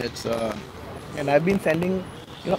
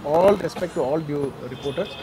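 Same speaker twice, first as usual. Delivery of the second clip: ordinary delivery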